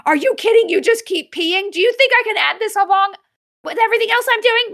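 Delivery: fed-up owner scolding a cat that keeps peeing; went off on the cat. Retold or imitated. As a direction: rant at the cat in disbelief. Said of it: "went off on her".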